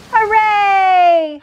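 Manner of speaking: high, long, loud cheer; animals cheering. A narrator's reading. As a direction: cheer it out, stretching the last syllable. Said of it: "the animals cheered".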